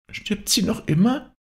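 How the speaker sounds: put-on very high small child's voice; child's question. A narrator's reading. Child asks father about the dying woman, high little voice. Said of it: "frug es".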